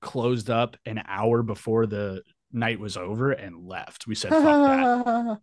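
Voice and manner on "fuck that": a much higher, louder send-off as they walk out; defiant retold shout. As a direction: spit it out as the parting shot. Band leaves the venue early.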